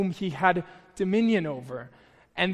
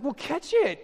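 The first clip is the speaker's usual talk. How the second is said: higher, matter-of-fact; mock sister's reply; 9 semitones higher than usual. Sister telling the uncle what to do, lightly voiced matter-of-fact. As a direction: toss off the obvious answer. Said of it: "she was like".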